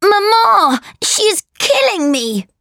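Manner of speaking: very high, wailing tattletale cry; hammed-up boy's howl to his mama; shouting for mother. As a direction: wail for help as if badly hurt. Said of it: "he shouted".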